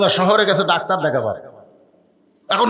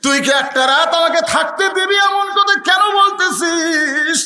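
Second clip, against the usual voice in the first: son's plea shouted, much higher and slow; pleading cry; 9 semitones higher than usual. Acted. Son pleads with his father, crying out high and slow.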